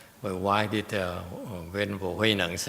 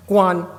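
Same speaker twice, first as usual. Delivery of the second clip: "Guan" much higher and louder, falling; stressed Guan; 10 semitones higher, 12 dB louder than usual. Shown as a demonstration. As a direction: punch "Guan" with a sharp fall.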